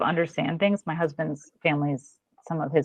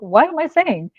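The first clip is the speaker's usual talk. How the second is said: higher, louder mock bafflement; baffled retold question; 10 semitones higher, 5 dB louder than usual. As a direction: ask it in playful bewilderment. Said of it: "I'd be like".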